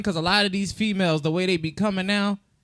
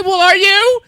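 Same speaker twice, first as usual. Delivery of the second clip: mock challenge; 12 semitones higher, 11 dB louder than usual. Retorting question in retold dialogue.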